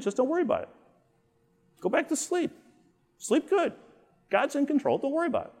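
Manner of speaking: husband gently soothes his sleepless wife; reassuring. Retold or imitated. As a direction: lull her back to sleep. Comforting wife at night.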